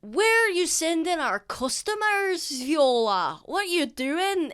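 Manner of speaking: high, loud scolding; indignant orc barkeep; orc's angry outburst. Acted. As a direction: call her out sharply for sending customers away.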